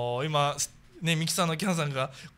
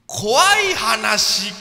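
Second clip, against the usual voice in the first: hushed creepy ghost story; 5 semitones higher, 10 dB louder, much slower than usual. Acted. Announcing this week's theme: ghost stories.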